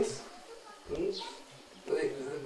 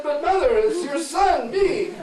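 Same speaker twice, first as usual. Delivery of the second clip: much higher, loud, pleading cry; pleading son; 13 semitones higher, 7 dB louder, slower than usual. Acted. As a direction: beg to be recognised.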